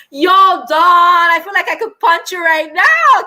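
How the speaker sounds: loud, high exasperated outburst; mock outrage. Real, not acted. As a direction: holler it at a friend who scared you. Mock outrage at Don's scare.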